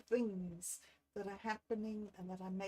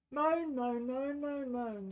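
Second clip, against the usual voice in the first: mock moaning; 3 semitones higher, 7 dB louder, slower than usual. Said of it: "I came on here to moan".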